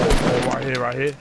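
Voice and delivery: loud, sudden alarm at the enemy; urgent callout. Real, not acted. Player shouting a warning to teammates, urgent. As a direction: yell it out as he appears.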